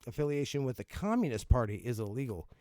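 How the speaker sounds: usual speaking voice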